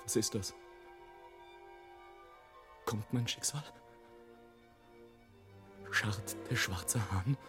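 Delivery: low, soft, wary questioning; hushed foreboding. Acted. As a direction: ask it under your breath, sensing doom. Fearful questions about his fate.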